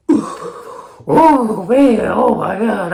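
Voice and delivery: high, loud "oh my God"; overwhelmed exclaiming. Retold or imitated. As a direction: gasp it out, totally blown away.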